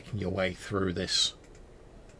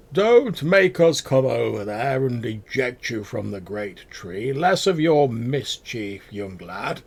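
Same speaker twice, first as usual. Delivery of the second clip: guard; 3 semitones higher, 7 dB louder, slower than usual. Acted. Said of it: "and they're like".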